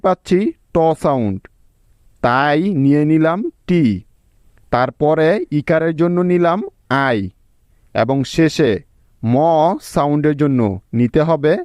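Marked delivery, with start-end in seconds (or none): none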